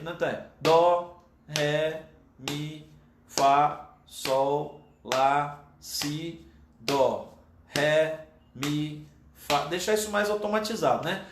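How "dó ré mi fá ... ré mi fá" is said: note names slowly, evenly spaced; one note per beat. Shown as a demonstration. Teacher demonstrating one note per beat to students, slow measured recitation. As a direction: recite each note separately with a pause after it. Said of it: "os nomes das notas em cima de cada tempo".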